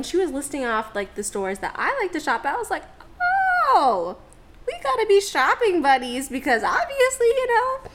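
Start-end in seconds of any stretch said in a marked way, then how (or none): 3.2-7.9 excited gushing. Retold or imitated. Gushing to new friend Delena.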